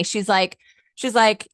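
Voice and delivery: ordinary delivery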